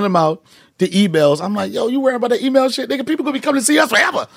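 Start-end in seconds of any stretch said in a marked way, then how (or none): 1.7-4.3 higher-pitched, rapid-fire dismissal; dismissive scoffing. Retold or imitated. Comic to tour partner about email cards, high scoffing. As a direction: imitate yourself brushing off a worry, high and fast. Quoting himself scoffing at a bandmate's worry.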